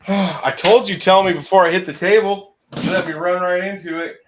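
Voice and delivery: loud complaint over engine noise; shouting over engine. Real, not acted. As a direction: raise your voice over the motor. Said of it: "I can't hear what you're saying over the engine".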